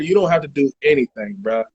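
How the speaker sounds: usual speaking voice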